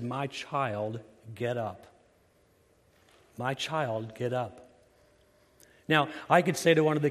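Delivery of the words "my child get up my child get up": put-on soft, gentle call to a child; tender call. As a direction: coax the girl awake tenderly. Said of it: "he speaks compassionately and soft".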